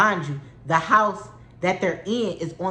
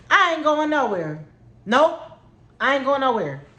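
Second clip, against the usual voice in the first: defiant shout; 6 semitones higher than usual. Retold or imitated. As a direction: dig in and shout it. Refusing to move car.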